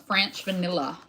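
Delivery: "French vanilla" with an odd twist on each word; weirdly said. Real, not acted. Crafter naming an ice cream flavor, oddly pronounced. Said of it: "I don't know why I said French vanilla like that".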